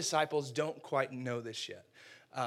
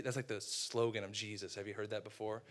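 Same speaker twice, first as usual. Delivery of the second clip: ordinary talk, nothing marked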